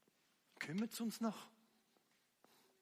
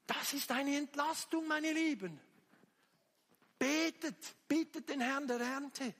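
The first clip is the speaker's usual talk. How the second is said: high, raised preacher's exhortation; impassioned preaching; 8 semitones higher, 4 dB louder than usual. Real, not acted. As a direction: call the congregation to prayer. (eindringlich mit erhobener Stimme gepredigt)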